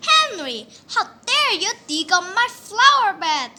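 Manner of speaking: loud, high furious scolding; angry mom. A narrator's reading. Mom yells at Henry over her flower bed, loud and high. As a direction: tell him off with fury.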